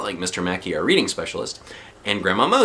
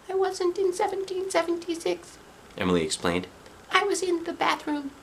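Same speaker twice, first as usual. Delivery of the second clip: put-on puzzled girl; 5 dB quieter than usual. Emily puzzled by everyone's shouting. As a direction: sound baffled by the fuss.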